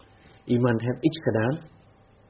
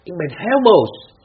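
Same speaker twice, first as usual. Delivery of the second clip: higher, louder, slow mock-angry complaint; mock anger; 8 semitones higher, 10 dB louder, slower than usual. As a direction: play the angry person, drawn out and loud. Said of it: "Je zegt". (gespeelde boosheid, hoger en luider)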